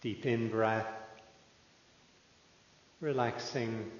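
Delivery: calm, unhurried meditation guide with long pauses; measured, settled voice leaving room to breathe; guided meditation. Real, not acted. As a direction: ease listeners into stillness, phrase by phrase.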